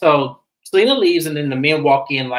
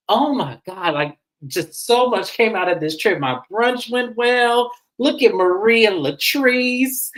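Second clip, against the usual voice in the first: higher, drawn-out delighted recap; mimicked happy gushing; 7 semitones higher, slower than usual. Recapper mimicking a hostess pleased her brunch went well, happy gushing. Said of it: "Letitia is so happy".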